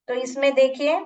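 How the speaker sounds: ordinary talk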